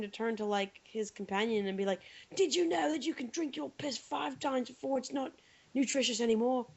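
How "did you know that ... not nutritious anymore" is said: imitating Bear Grylls in a vague put-on accent; a mock survival-host voice, accent unclear; unplaced accent. Imitating a TV survivalist to her companion, vague put-on accent. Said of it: "I don't even know what accent that was".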